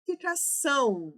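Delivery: "Ficação" with a long, punched ÇÃO at the end; final syllable stressed. Shown as a demonstration. Teacher shows where stress falls, "Ficação" with ÇÃO drawn out. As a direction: lean hard on the end of "Ficação", fi-ca-ÇÃÃO. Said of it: "a sílaba tônica está no ão".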